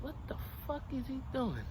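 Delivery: hushed disbelief. Retold or imitated. Man retelling his shock at the stranger, hushed disbelief. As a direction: puzzle it out under your breath. Same reaction on getting closer.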